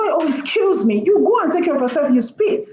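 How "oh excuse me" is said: polite excuse. Retold or imitated. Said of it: "you say".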